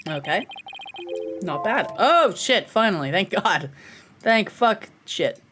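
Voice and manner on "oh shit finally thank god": ordinary talk, nothing marked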